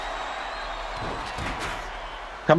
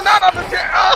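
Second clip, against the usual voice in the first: shocked shout, much higher; 9 semitones higher, faster than usual. Real, not acted. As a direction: yell in disbelief. Reacting to chair shot.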